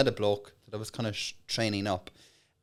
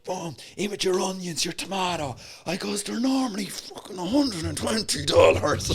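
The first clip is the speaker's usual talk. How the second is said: big loud pitch voice; 6 semitones higher than usual. Acted. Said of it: "changed my whole persona, big loud voice".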